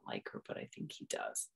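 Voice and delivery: secret whisper; whispered knowing aside. Real, not acted. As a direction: let them in on a secret. Teasing aside.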